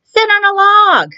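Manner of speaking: high, sing-song cat order; bossy cat. Acted. Cat tells frog to sit on a log, high bossy voice. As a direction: tell the frog where to sit, high and cartoonish.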